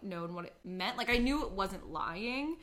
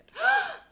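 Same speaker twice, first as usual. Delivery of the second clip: sharp, high gasp of excitement; excited gasp; 9 dB louder than usual. Real, not acted. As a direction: gasp loudly in delighted anticipation. Gasps at the moon-creation topic.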